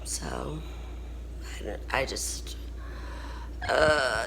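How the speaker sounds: whispered, on the edge of tears; a faltering whisper, breath catching; barely getting words out; choked whisper. Real, not acted. Near tears, oxygen dropping.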